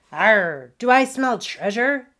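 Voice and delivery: lightly voiced snappy pirate pug; loud pirate challenge, rising high; snapping. A narrator's reading. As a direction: snap it out like a small pirate. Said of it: "the furry brown thing snapped at Wally".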